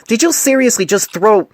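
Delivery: outraged he was hit; indignant disbelief. A narrator's reading. Painless to the mare who threw a chart, indignant cry.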